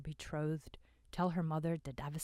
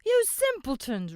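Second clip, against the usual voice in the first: much higher, loud roar; a furious roar at fools; 11 semitones higher, 13 dB louder than usual. A narrator's reading. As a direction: roar it in contempt. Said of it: "roared a voice".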